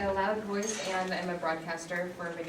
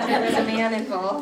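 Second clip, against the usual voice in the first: louder, spoken through laughter; laughing; 3 semitones higher, 8 dB louder than usual. Real, not acted. Speaker joking to host about why she moved, laughing. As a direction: toss off the joke while still laughing. Joking reply to host's teasing.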